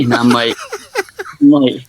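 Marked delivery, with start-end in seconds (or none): 0.6-1.4 high laugh. Real, not acted. Laughing while retelling backstage mix-up.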